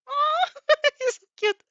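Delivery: high squeal breaking into laughter, slow; laughing break. Real, not acted. Reader cracks up at the boy's sweet offer. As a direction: loses it mid-line. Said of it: "What is voice?".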